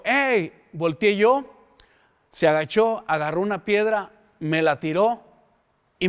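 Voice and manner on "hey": short sharp call, higher; mild mock yell of hey; retold shout. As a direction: call out hey to stop someone. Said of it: "me gritó y me dijo".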